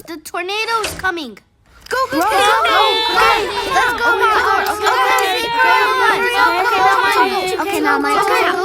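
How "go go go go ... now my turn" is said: loud, fast frantic rush. Acted. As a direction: scramble in a panic. Frantic rushing in storm.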